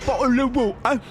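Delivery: "Bottle of water" glottal t's, high and plummy; high mock British "Bottle of water"; exaggerated British accent. Shown as a demonstration. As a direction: lean into "Bottle of water" as a Cockney-posh cartoon. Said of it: "Any words you'd like to say?".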